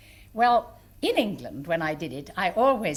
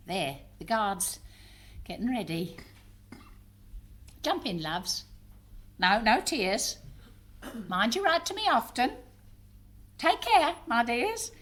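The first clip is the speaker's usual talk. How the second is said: tender farewell; 4 semitones higher than usual. Acted. Mother seeing loved ones onto train, tender farewell. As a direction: hold back the tears while seeing them off.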